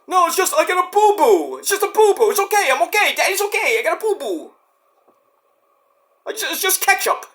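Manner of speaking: put-on baby-talk the kids into calm; soothing dad.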